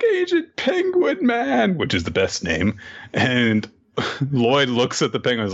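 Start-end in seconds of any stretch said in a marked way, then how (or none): 0.0-1.8 put-on very high, slow child wail; put-on high cartoon kid; little kid voice. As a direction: draw it out like a small child. Voices child Anya naming the toy.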